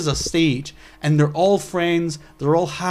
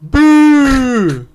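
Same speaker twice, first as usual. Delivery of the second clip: a much higher, loud jeering boo; mock boo; 10 semitones higher, 9 dB louder than usual. MP heckling from the benches, loud boo. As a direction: jeer it out like a panto heckler.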